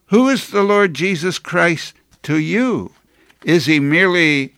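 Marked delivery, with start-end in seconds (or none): none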